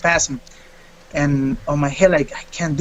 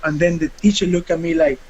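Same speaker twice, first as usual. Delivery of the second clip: usual speaking voice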